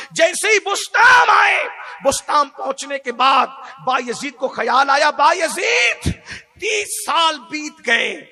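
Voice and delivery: loud, high sermon voice; preacher voicing Bayazid's thoughts, loud; fiery preaching. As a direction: drive the story home to the crowd. Preaching at full force.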